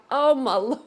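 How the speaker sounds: usual speaking voice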